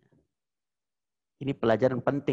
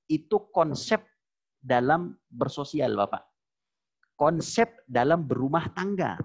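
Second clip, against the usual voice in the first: normal talking voice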